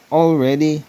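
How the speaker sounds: called out loud and high to the crowd; calling out. A narrator's reading. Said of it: "he called".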